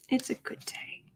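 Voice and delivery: a private whispered echo to herself. Real, not acted. Host echoing her own words to herself, whispered. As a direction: repeat it in a soft unvoiced whisper. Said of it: "Oh, it's a good day".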